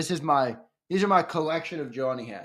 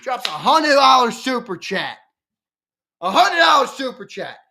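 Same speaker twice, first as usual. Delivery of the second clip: higher, louder, amazed; excited announcement; 10 semitones higher, 6 dB louder than usual. Real, not acted. Streamer amazed by a viewer's hundred dollar super chat, excited. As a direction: announce it in wonder. Reacting to donation.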